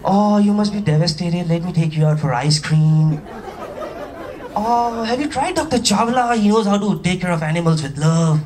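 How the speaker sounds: drawn-out, gushing pity; syrupy fake concern with long vowels; mock cooing sympathy. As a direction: coo it slowly like a fawning commenter. Mock sympathetic comments voiced.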